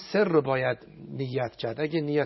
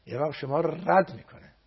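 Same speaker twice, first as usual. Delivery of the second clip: normal talking voice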